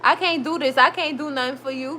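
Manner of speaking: usual speaking voice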